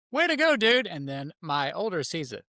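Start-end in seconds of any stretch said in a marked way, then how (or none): 0.1-0.8 high, loud praise; retold proud whoop; cheering on. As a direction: cheer your kid on, pitch up. Dad cheering son on.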